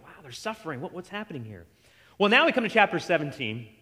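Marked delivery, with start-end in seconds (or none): 0.0-1.7 soft stunned murmur; hushed bewilderment. Retold or imitated. Disciples stunned by the rebuke, hushed. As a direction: pull way back, awed and quiet.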